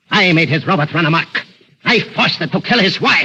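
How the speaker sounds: rising, exultant villain; gloating boast. Acted. Mad doctor boasts to his captive of murder. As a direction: relish every crime.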